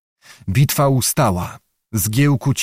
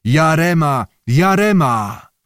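war cry; 3 semitones higher, slower than usual. A narrator's reading. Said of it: "górował krzyk napastników".

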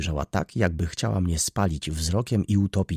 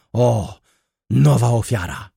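loud, raised, drawn-out exclamation; crying out; 4 semitones higher, 8 dB louder than usual. A narrator's reading. Baroness pointing at a new victim, crying out. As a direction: cry out dramatically. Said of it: "wykrzyknęła baronowa".

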